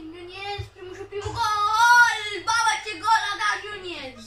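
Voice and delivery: loud, high excited yell over the goal; goal celebration. Real, not acted. Gamer yelling at his own goal, loud and high. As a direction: shout it like the striker just scored. Player scores first goal in FIFA.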